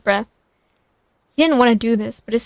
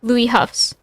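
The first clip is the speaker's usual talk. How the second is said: plain reading voice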